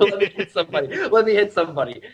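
high-pitched, scrappy little fighter; playful mock-pugnacious squeak; Scrappy-Doo. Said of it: "like, all scrappy do".

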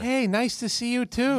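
high, sugary mock-polite voices; fake friendly chitchat. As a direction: play empty small talk, overly sweet. Mock fake friendly goodbye exchange.

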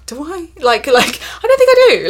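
high, laughing disbelief; doubtful surprise. Retold or imitated. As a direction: squeak it out, half-laughing in disbelief. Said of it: "I'm like".

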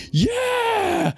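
big high cheering yell from the whole bar; crowd cheer. Retold or imitated. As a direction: shout it like a crowd egging on a trick.

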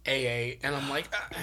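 usual speaking voice

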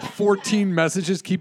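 ordinary talk, nothing marked

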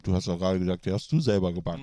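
talking through a mouthful of cookie; words around a bite of biscuit; mouth full. Real, not acted. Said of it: "Aber mit vollem Mund macht man eigentlich keinen Podcast". (mit vollem Mund)

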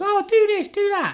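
high, whiny mock voice; mocking whiny demand. Podcaster mocking listeners' demands in a high whine. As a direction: mimic the bossy listeners, way up high. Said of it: "don't start fucking saying to us".

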